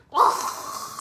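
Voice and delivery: loud, exasperated "ugh"; exasperated noise of disgust; disgusted groan. Real, not acted. Disgusted reaction to his Facebook post.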